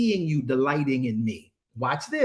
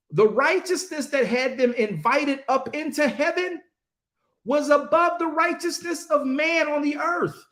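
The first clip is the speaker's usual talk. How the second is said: high-pitched fervent preaching; impassioned preaching; 9 semitones higher than usual. Real, not acted. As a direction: preach it with full fire. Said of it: "I'm very passionate about this".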